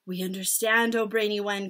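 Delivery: ordinary delivery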